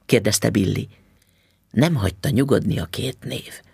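ordinary delivery, nothing marked

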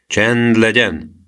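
drawn-out, mildly gruff command; grumbled order. A narrator's reading. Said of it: "dörmögte az őrmester".

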